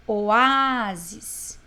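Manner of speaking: Oásis with a loud, lengthened Á; stress on Á. Shown as a demonstration. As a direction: hit and hold the A in Oásis. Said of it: "ó, o A". (Oásis com o A bem forte e alongado)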